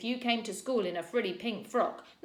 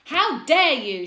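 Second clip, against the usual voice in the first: furious shout, much higher and louder, slow; 8 semitones higher, 15 dB louder, slower than usual. A narrator's reading. Teacher shouting at two boys, furious shout. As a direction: bellow it at the fighting boys. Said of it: "shouted Missus Collins".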